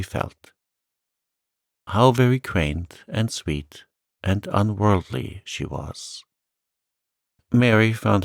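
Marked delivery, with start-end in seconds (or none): none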